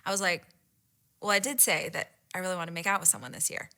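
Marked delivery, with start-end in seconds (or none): 1.2-3.7 cool, low, quick delivery; imitating a straight-faced bold admission; stone cold. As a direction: toss it out coolly and quietly. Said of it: "like, stone cold".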